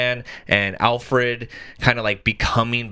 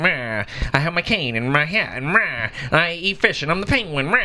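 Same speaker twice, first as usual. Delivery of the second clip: higher-pitched, squawking villain impression; Danny DeVito's Penguin; 4 semitones higher than usual. Host imitating the old movie Penguin, squawky impression. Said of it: "where he's like".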